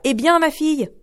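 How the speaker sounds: a loud, high eager call from the mother. Acted. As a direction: cry out eagerly to her daughter. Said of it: "elle lui cria".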